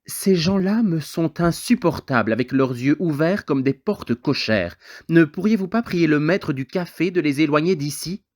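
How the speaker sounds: exasperated, high-pitched demand to be rid of them; indignant beloved. A narrator's reading. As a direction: complain in a high, insistent voice. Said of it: "quand vous me dites".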